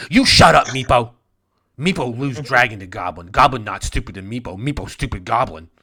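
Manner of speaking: loud, fast scolding in broken speech; angry dwarf queen. Acted. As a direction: snap at Meepo, loud and quick.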